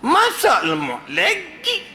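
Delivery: high, slow complaining whine; whiny husband. Acted. Husband complaining to wife about repeated curry. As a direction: whine about the same dish again. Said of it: "panggil laki jebon ni".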